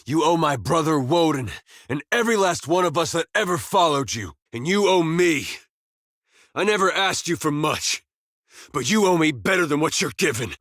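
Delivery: high-pitched, impassioned accusation without shouting; furious reckoning. Acted. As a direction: call in a debt with rising indignation. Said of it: "like a man overtaken by a furious spirit".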